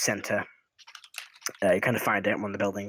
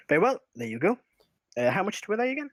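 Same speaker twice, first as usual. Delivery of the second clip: a slightly higher shopkeeper voice; lightly voiced halfling merchant, polite; 9 semitones higher, faster than usual.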